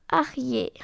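sighed little lament; sighing. A narrator's reading. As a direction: let it out with a sigh. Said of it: "seufzt Nancy". (seufzend beklagt)

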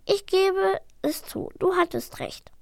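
high, slow giving in; grudging admission. A narrator's reading. Dragon conceding to his brother, grudging. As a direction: concede reluctantly. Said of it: "murmelte Furor".

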